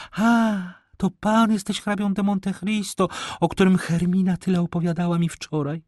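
high lady's voice, full of recognition; amazed lady. Acted. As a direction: exclaim high, as if just recognising him. (zdumiona dama, wysokim głosem)

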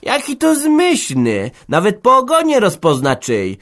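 mock him sweetly. Old woman mocks the smith's claim, scornful. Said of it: "szydziła stara".

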